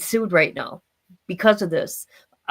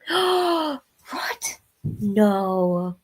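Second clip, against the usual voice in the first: shocked gasp. Real, not acted. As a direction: gasp in disbelief. Shocked by someone's vacation.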